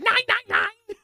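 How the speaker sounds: high, shouted mock-German accent; hammed-up German shriek. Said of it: "In Germany, it's more like".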